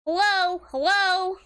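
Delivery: high, loud robot voice; robot's high, loud hello; chirpy greeting robot. Acted. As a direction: call out as an eager high-pitched robot. Voices robot character in game.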